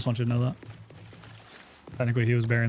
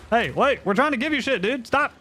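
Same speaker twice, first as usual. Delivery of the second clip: calling down a hallway; 12 semitones higher than usual. Real, not acted. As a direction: shout after someone walking away. Calling out to a stranger in-game.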